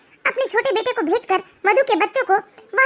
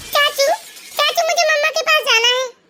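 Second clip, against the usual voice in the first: put-on crying child; 4 semitones higher than usual.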